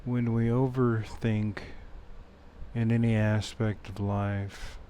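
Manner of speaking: soft, unhurried meditation voice with long pauses; guided meditation. Acted. Meditation teacher leading listeners back to the breath, soft guided-meditation voice. Leads a meditation after intro.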